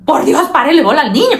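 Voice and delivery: a bystander losing patience; exasperated. Retold or imitated. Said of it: "uno tiene ganas de decirle". (exasperada, un poco más fuerte y aguda)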